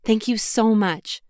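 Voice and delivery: ordinary delivery, nothing marked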